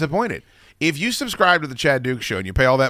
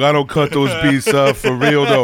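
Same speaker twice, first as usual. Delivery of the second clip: slow, louder swaggering producer; hip-hop producer voice; 5 dB louder, slower than usual. Pretend. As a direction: lean back and brag like a beatmaker. Plays a music producer.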